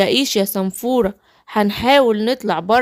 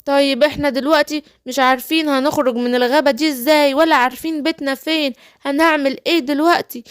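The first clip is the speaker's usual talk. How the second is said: alarmed little Smurf, higher; 6 semitones higher, faster than usual. Pretend. Smurfs panicking after vanishing. (سنفور صغير مفزوع بصوت أعلى)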